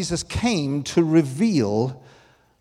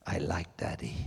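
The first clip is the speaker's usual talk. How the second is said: tender; 11 semitones lower, 11 dB quieter than usual. Real, not acted. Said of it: "tender confession".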